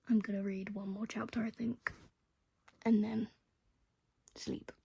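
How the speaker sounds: soft unvoiced whisper, winding down; sleepy hushed whisper. Real, not acted. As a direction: murmur it as if to yourself at bedtime. Bedtime plan whispered.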